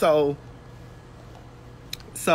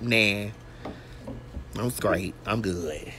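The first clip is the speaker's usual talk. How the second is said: low, soft and dismissive, waving it away; a lower, softer shrug of a voice telling herself she's fine; mock-casual denial, low and quiet; brushing it off; 9 semitones lower, 8 dB quieter, slower than usual. Brushing off sore throat, retold.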